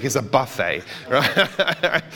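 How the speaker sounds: chuckling through the words; amused, laughing aside. Real, not acted. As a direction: laugh as you let him off the hook. Teasing a congregant.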